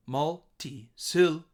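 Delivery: Multi-syllable broken up, MUL, SIL and BLE lifted; three stressed syllables. Shown as a demonstration. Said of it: "three places where my voice goes a little higher".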